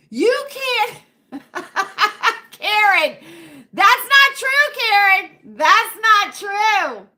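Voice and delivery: loud, high, drawn-out objection; playfully outraged, stretching the words slowly; mock protest. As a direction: protest loudly through amusement. Laughing protest at a commenter.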